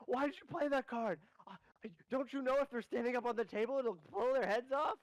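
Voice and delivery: high, incredulous scolding; scolding character voice. Acted. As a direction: ask in a high shocked know-it-all voice. Mister Open Book scolding.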